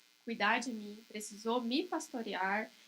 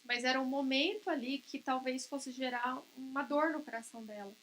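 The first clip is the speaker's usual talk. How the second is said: ordinary delivery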